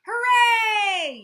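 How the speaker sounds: high, very loud cheer; party guests cheering together, very loud; cheering yell. Acted. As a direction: shout hooray with the whole crowd. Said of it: "yelled everybody".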